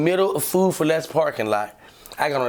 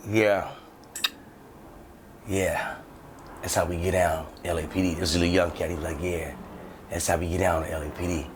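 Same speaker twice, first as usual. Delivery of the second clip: smug cop; 10 semitones lower, 7 dB quieter than usual. Retold or imitated. Young cop boasting quietly.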